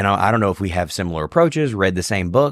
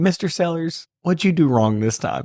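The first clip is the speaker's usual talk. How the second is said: imitating professor; 8 semitones higher than usual. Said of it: "he would be like".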